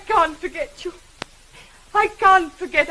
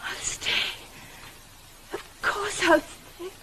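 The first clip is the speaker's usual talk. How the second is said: tender acceptance; 4 semitones higher, 4 dB quieter, slower than usual. Acted. Jane accepting his proposal.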